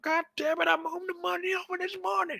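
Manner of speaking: high, whining complaint; mock whiny friend. Friend Jazz whining about the money, in a high whine.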